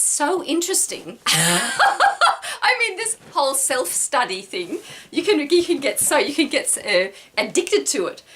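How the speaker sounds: giggly, delighted rush of words; laughing. Real, not acted. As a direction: let the laugh take over the sentence. Bursts out laughing mid-sentence.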